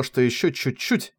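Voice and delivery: ordinary delivery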